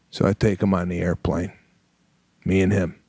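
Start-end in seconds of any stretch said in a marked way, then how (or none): none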